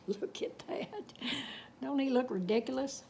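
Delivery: usual speaking voice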